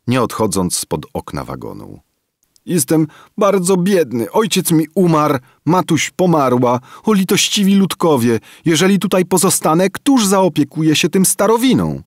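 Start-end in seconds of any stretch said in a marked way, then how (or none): 2.7-12.0 high, loud whining beg, pushed fast at the end; wailing plea. A narrator's reading. Boy wailing at the train window for pity, high and loud. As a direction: wail for pity like a beggar boy. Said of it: "Kim podniósł głos i zaczął beczeć".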